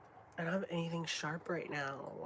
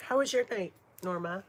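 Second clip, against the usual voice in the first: pet voice; 3 semitones higher, 7 dB louder, faster than usual. Real, not acted. Talking to her pet.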